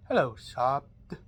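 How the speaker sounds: low villain greeting. Acted. Villain greeting the hero who came to stop him, low sly menace. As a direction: greet your enemy with quiet menace.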